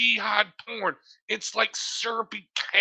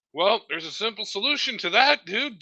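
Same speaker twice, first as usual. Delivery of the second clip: usual speaking voice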